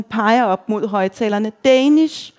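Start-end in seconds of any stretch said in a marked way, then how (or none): 1.6-2.3 high, raised call over music. A narrator's reading. Reveller to young bartender, pointing at speakers, calling out. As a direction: call the word up over the din. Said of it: "råber jeg til den yngste bartender".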